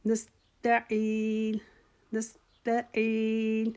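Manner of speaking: نستعین said whole, very slow; joined slow recitation. Shown as a demonstration. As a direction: blend نستع and عین, drawn out. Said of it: "دونوں کو ملا کے پڑھ لیں".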